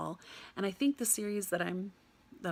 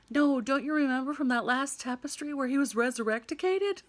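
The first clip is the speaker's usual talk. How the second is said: higher pitched, mock-earnest question; mock correction; 6 semitones higher, 4 dB louder than usual. Traveller turning round to correct the tourist, mock-earnest. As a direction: tease with a put-on innocent reminder.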